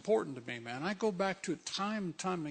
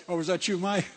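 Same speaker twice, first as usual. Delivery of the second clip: ordinary delivery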